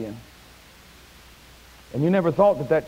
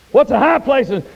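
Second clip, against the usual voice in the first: much higher, much louder, HIGH ringing out; shouted preacher's call; 10 semitones higher, 9 dB louder, slower than usual. Shown as a demonstration. Preacher shouting the question to his congregation. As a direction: cry it out to the whole church.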